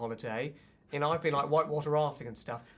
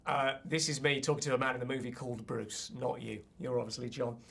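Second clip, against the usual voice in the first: usual speaking voice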